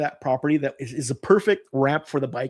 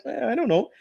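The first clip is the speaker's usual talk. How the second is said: higher, innocent shrug of a reply; shrugging; 8 semitones higher than usual. Retold or imitated. Boy feigning ignorance to his mother, mock innocent shrug. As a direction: play dumb with a shrug in the voice. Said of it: "shrugged my shoulders and kinda went".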